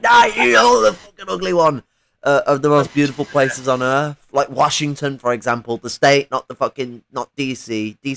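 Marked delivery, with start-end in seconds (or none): 0.0-1.8 high, loud put-on squabbling voice; a snippy rival passing off the worse prize; mock quarrel. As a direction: shove the ugly one onto the other guy. Imagined nations quarrelling over land.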